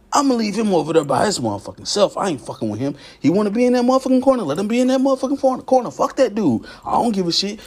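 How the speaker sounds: high-pitched, scornful write-off; rapid, high, fed-up brush-off in the middle; indignant dismissal. Acted. Acts out people writing him off.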